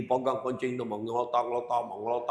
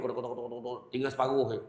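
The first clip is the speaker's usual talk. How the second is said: ordinary talk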